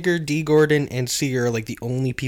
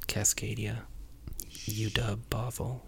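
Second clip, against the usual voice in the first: hushed aside whisper; 13 dB quieter than usual. Real, not acted. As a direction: whisper it like a confession. Sheepishly admitting lesser schools.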